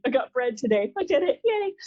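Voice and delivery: self-mocking triumphant yay; mock celebration. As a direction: cheer a trivial win as if it were huge. Mocking own tiny achievement.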